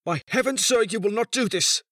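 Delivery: high, loud protest; bursting out. A narrator's reading. Son defies the old man, bursting out. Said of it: "burst out the young man".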